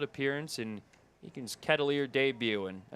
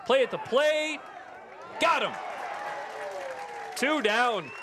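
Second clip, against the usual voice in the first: excited play-by-play; 5 semitones higher than usual. Real, not acted. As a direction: build up the call to the plate. Live call of a play.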